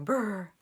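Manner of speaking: loud, rattling brr of a shiver from the cold. Shown as a demonstration. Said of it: "like you're shivering".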